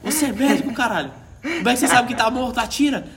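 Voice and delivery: high furious yelling. Retold or imitated. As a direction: yell it at him, swearing. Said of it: "o cara gritando lá dentro do tanque".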